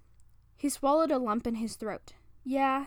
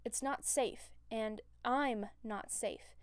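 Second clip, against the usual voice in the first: softer, slowing to a near stop; quiet confession; 8 dB quieter, slower than usual. Acted. Bruce warning Clint he is dangerous, soft and slow. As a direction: let it fall away, heavy and slow.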